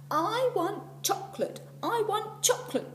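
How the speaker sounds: very high, loud, slow bawling; girl in a tantrum bawling for chocolate. A narrator's reading. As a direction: scream it like a furious child. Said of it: "bawled the girl".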